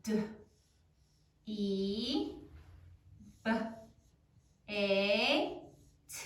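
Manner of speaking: isolated sounds, long pauses; dictation sound by sound. Shown as a demonstration. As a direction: say each sound as if writing it. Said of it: "say it as you write it".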